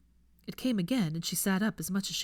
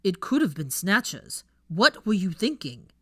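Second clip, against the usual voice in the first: angry scolding; 7 dB louder than usual. Acted.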